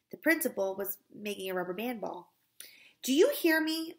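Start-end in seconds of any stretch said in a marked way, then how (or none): 3.0-4.0 high, loud, insistent question; exasperated demand. A narrator's reading. Teacher to the principal ignoring her, exasperated demand. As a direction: demand an answer from someone ignoring you.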